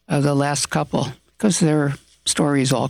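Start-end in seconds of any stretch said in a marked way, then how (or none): none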